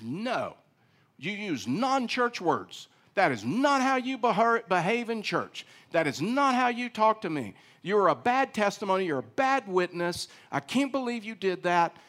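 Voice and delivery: high, loud self-righteous rebuke; harsh scolding. Pretend. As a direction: scold him like a stern church judge. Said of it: "if I had truth without love".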